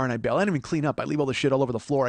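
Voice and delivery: usual speaking voice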